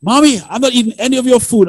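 put-on high, quick little-boy voice, refusing; child's voice. Son refusing his mother's cooking, high quick child voice. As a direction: imitate a small boy whining at dinner. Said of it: "And Aaron's like".

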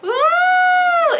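high shouted surprise; loud high ooh. Real, not acted. Opening a fabric gift, a high squeal.

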